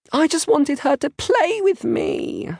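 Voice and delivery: put-on very high, slow child's wail; sobbing. A narrator's reading. Isla explaining herself to big sister, sobbing. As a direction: wail it like an upset little girl. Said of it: "Isla sobs".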